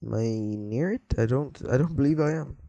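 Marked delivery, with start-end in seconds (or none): none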